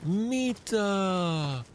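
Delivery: high, ringing shout of "Mita"; enemy AI callout. Retold or imitated. Streamer mimics the enemy's high Finnish shout. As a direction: call it like the enemy soldier.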